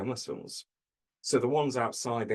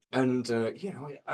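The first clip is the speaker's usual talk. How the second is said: usual speaking voice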